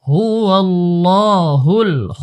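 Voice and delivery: হুয়াল্লাহুল with the first lam thick, the next lam thin; heavy then light lam. Shown as a demonstration. Teacher shows students the thick and thin lam, careful recitation. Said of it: "প্রথম লাম মোটা, তারপরের লাম পাতলা".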